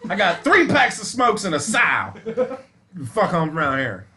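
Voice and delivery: a hint of a rough country bidder; old-time bidder voice. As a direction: play a gruff auction buyer. Bidding as old-time buyer.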